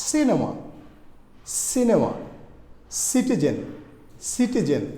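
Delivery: "সিনেমা" and "সিটিজেন" with a long s at the start; each word isolated, falling at the end; hissed initial C. Shown as a demonstration. Teacher drills C words, deliberate lengthened s. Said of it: "একটু হালকা বাতাসও আসতে পারে".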